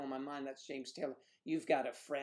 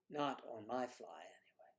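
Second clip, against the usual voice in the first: whispered aside; 8 semitones lower, 12 dB quieter, much faster than usual. Real, not acted. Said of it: "joke aside".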